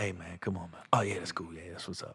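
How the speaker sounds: very low, soft mock-creepy murmur; hammed-up low confidential voice; creepy whispering. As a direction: play a hushed creep close to the ear. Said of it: "all in the creepy voice".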